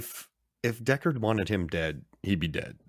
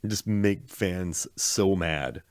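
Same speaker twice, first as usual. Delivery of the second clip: ordinary talk, nothing marked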